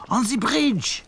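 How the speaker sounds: put-on high, French-accented on the bridge. A narrator's reading. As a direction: point it out like a Frenchman. Claude's French voice.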